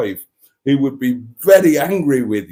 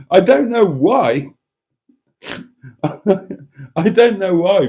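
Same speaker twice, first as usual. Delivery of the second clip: laughing; 6 semitones higher, 4 dB louder, slower than usual. Real, not acted. Amused confession of watching shopping videos.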